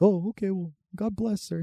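high, softening meek farewell; crackhead impression. Crackhead leaving after dad's scolding, high meek impression. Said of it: "he's like".

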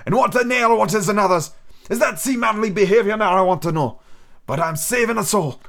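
put-on a sick old sailor coaxing the boy; old pirate captain. Captain raging about pursuers.

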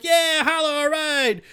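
high, loud imitation of cheering fans; cheering crowd. Happy crowd answers the comedian, excited cheer. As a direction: whoop it back like a pumped audience.

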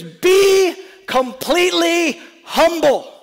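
stern Paul command. Acted. Paul voiced giving command.

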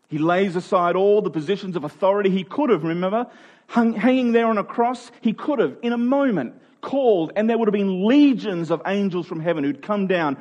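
fervent preacher, swelling in force; rising preaching. Acted. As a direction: preach it up toward the climax. Preaching builds to the cross.

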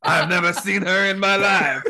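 loud, high mock French accent with laughter; hammed-up French pirate bellow; bad French pirate accent. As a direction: join in hamming up a French captain. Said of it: "that bad French captain pirate thing".

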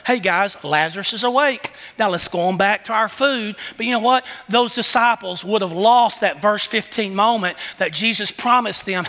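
ordinary talk, nothing marked

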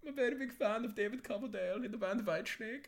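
high, hammed-up German caricature; bad comic German accent. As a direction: chat away in a high, silly German voice. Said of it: "I was in this voice this morning".

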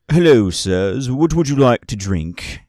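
a formal server welcoming guests; polite bartender. Acted. Bartender greeting travellers at the bar, courteous and formal.

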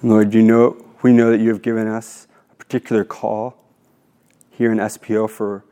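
low, hushed prayer voice, lingering on each line; spoken prayer. Acted. Leads an opening prayer.

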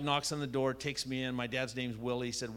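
ordinary delivery

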